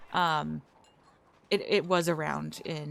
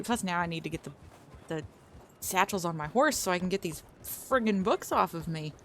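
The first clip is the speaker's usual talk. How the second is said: ordinary delivery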